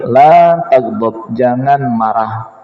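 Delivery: low, slow and measured; slow solemn advice. Retold or imitated. Preacher voicing the Prophet's answer, slow and grave. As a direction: let each word of the warning land slowly. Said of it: "Kata Nabi".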